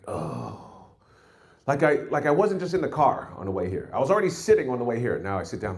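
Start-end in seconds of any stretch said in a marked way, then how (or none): 0.1-0.6 put-on old man groan. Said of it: "I'm like".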